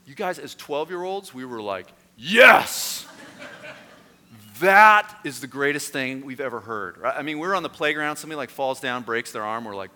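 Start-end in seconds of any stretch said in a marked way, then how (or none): 2.2-7.0 put-on loud, high-starting boyish exclamation; ecstatic cheer. Twelve-year-olds hearing the Conan line, hammed-up thrill. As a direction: whoop it out, then gush.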